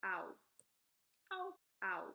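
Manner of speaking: "ow" as one gliding vowel; teacher's isolated "ow"; letter sound demo. Shown as a demonstration. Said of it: "O W".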